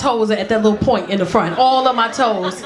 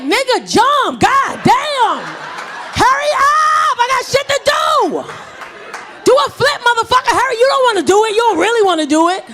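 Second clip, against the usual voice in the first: put-on yelling at kid; 11 semitones higher than usual. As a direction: shout up at him to hurry and jump.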